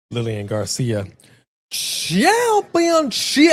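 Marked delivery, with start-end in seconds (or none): 1.7-3.5 high, slow, drawn-out ring announcer call. Retold or imitated. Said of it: "the ring announcer, Lillian Garcia".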